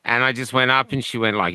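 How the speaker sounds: normal talking voice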